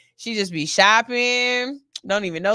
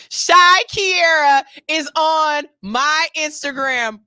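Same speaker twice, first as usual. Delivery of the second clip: slow, louder and higher in awe; starstruck; 4 semitones higher, 5 dB louder, slower than usual. Real, not acted. Host tells viewers the star is watching, awestruck and slow. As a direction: announce it breathless with disbelief. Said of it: "I feel starstruck".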